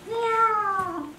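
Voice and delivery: high mock cat meow; sulky cat meow. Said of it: "and she'd go".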